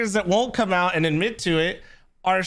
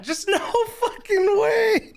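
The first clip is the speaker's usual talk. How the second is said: laughing disbelief; 11 semitones higher, slower than usual. Real, not acted.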